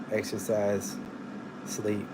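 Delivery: usual speaking voice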